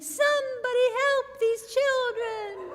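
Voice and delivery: high, laughing imitation of a panicked tourist; mock scream. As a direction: squeal it out like an alarmed tourist. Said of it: "people would scream".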